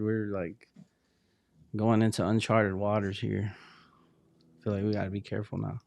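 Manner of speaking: usual speaking voice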